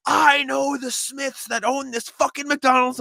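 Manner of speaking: high, drawn-out entitled yell; imitated screaming. Retelling a customer yelling at the manager, imitated screaming. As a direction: scream it slowly like an outraged customer, mocking him. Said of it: "he just starts screaming".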